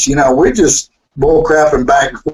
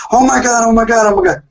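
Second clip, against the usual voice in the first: 1 mock fan gushing; 7 semitones higher, faster than usual. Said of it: "run up saying".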